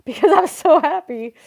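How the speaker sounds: retold happy tears, half crying. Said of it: "retold happy tears".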